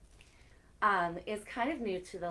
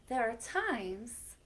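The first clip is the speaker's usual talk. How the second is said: lively storybook read-aloud; reading to children. A narrator's reading. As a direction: tell it like story time for kids. Reads picture book aloud.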